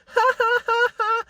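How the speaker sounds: imitating her sarcastic witchy laugh; witch's cackle. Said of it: "like a witch's cackle".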